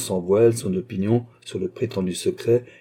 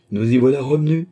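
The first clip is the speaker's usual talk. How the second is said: higher, louder burst of mirth; amused exclamation; 5 semitones higher, 6 dB louder than usual. A narrator's reading. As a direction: exclaim with lightly acted amusement, no real laugh. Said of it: "s'écria Marie-Antoinette en riant".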